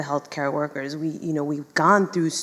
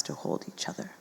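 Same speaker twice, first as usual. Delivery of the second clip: emotional whisper; 13 dB quieter, faster than usual. Real, not acted. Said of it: "what just happened in my body".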